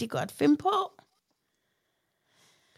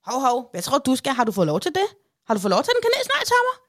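shrill, much higher mock scolding; high-pitched nagging interrogation; scolding grandma; 6 semitones higher, 4 dB louder, faster than usual. Grandma catching grandson with a cinnamon roll, shrill scolding. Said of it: "kom ud i køkkenet til mig og sagde".

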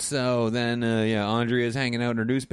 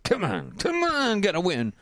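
feverish rooting; 8 semitones higher than usual. Retold or imitated. Brandon at the radio during his bet game, feverish. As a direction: mock-desperate fan, leaping high. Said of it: "Brandon is feverishly listening to the game".

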